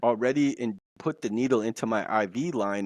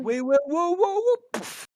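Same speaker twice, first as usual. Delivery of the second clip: high, strained panic as it flies at you; high frightened squeal, half laughing; panicked cry; 3 dB louder than usual. Retold or imitated. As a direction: yelp in alarm before the hit, rising to a cry on poof. Ball thrown at him, panicking.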